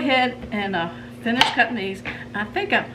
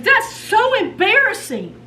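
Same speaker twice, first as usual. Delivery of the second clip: slow, high, louder groan of embarrassment; mock mortified teen; 12 semitones higher, 6 dB louder than usual. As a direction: drag it out like a squirming teenager. Daughter mortified, mom imitates her.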